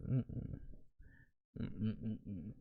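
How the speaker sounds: hushed, breathy mock whisper; mock ASMR whisper. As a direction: whisper it like a tingle video. Mocking ASMR, whispery hum.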